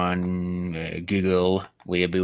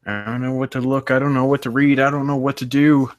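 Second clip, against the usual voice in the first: higher and building to louder, a whiny moan; whiny, rising complaint; 7 semitones higher, 3 dB louder than usual. Real, not acted. Bored streamer complaining to chat, whining louder each time. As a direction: whine like you're bored out of your mind.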